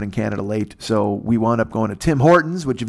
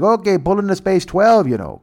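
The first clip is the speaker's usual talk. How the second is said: Canadian guard voice; 7 semitones higher, 3 dB louder than usual. Retold or imitated.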